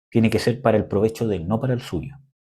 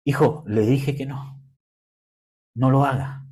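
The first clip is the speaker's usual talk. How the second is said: slow, calm firm words to the son; calm correction. Retold or imitated. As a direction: correct the child slowly and calmly. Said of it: "Pero ahí es donde yo digo".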